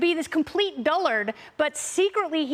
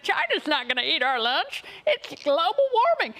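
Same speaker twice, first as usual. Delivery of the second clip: Joe Biden; 2 semitones higher than usual. Retold or imitated. Panelist quotes the president on China to the others, lightly mocking. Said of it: "'Cause he's like".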